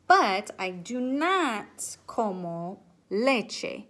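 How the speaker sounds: But I do not como leche, spoken very slowly with weight on not; slow emphasis. Shown as a demonstration. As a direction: draw out each word to make the point. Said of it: "teaching contrast".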